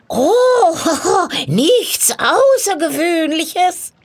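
high, loud witch voice with a laugh; cackling witch. Acted. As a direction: brush it off with a witchy chuckle. Witch makes light of the task. (kichernde Hexe, hohe Stimme)

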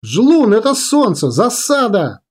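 high-pitched shouted radio report; urgent alarm. A narrator's reading. Girl warning command of ambush, urgent shout. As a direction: raise the alarm over the radio.

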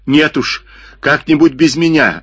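high, anxious refusal; scared refusal. A narrator's reading. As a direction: back away, frightened. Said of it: "ответил Джипа, пятясь назад". (испуганный отказ)

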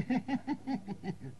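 high, soft staccato laugh; imitated chuckle. Old man ending his remark about Parle, high mock chuckle.

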